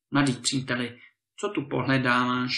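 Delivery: ordinary delivery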